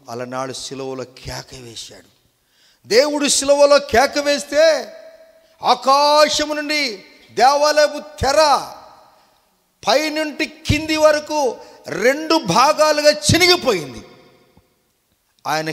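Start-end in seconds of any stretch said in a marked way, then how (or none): 2.9-14.0 very high, loud declamation; full-force preaching. Real, not acted. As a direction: thunder it out in separate, emphatic bursts. (ఎత్తైన గొంతుతో గట్టిగా ప్రసంగం)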